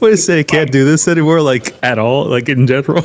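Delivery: high incredulous question; surprised. Real, not acted. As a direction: disbelief. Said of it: "surprised reaction".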